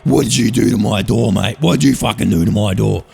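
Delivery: low menacing mock anger; angry Brody. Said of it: "He was fucking angry".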